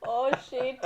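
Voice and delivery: mock dismay. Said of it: "You can just see in his face".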